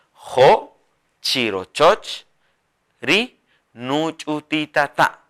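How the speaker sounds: Jo, chirochoch, ri nuchutitata said apart and slow; slow broken dictation. Shown as a demonstration. Teacher dictates the sentence in pieces. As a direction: piece the phrase out slowly. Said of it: "Así".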